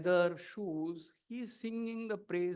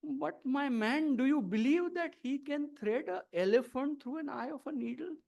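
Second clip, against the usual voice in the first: puzzled; 6 semitones higher than usual. A narrator's reading. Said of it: "Narad gets puzzled".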